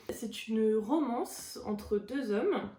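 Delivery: ordinary delivery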